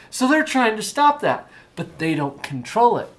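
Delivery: normal talking voice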